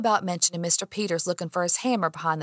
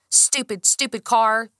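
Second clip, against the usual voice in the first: frustrated outburst; 4 semitones higher, 4 dB louder than usual. Acted. Kicks her locked car.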